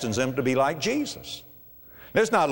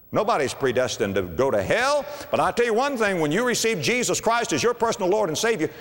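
ordinary talk, nothing marked

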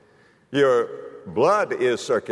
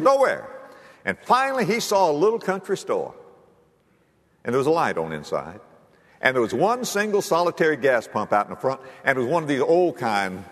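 usual speaking voice

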